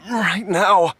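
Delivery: urgent insistence. Acted.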